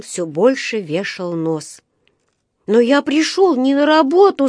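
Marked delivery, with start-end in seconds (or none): 2.7-4.5 high, louder protesting cry; закричал. A narrator's reading. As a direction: cry out to be heard at last. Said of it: "закричал он". (протестующий выкрик, высоко)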